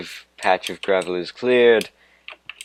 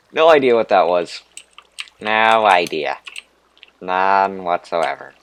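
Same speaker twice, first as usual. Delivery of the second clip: put-on Valley Girl voice; 7 dB louder than usual. Gamer lost in a cave, goofing in a Valley Girl voice. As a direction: slide into a ditzy Valley Girl lilt. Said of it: "That's a really weird voice".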